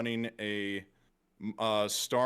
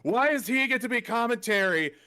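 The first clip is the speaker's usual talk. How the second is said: whiny outraged rant, much higher and louder; tantrum; 11 semitones higher, 5 dB louder than usual. Retold or imitated. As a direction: throw a petty indignant fit. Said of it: "threw a huge tantrum".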